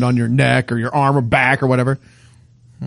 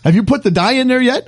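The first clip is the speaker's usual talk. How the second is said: urgent doctor; 8 semitones higher than usual. Retold or imitated. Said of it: "He came in and goes".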